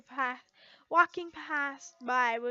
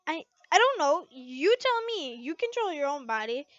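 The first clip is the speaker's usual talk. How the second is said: flustered Lucy; 2 semitones higher, 6 dB louder than usual. Acted. Lucy voiced in the story.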